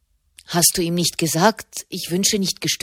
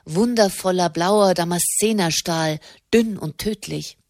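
plain reading voice

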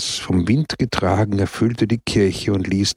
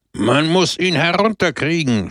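higher, shouted outrage; outraged shout; 7 semitones higher than usual. Acted. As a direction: yell for help in indignation. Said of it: "schrie der Redner empört".

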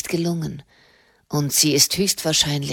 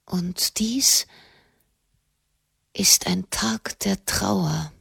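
slow, whispered mourning; a hushed, slow lament; grieving whisper; slower than usual. A narrator's reading. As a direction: breathe it out in grief. Grieving after orphan line.

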